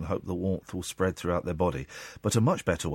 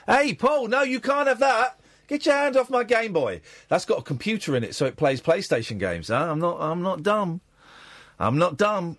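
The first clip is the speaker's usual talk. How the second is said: indignant shouting; 8 semitones higher than usual. Pretend. Host warning Paul off the Game Boy, high indignant yell.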